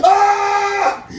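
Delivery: sudden high-pitched scream. Acted. Comic alone in a room, past cursing, screaming. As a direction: let out one loud shriek. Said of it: "I wanted to scream that on stage".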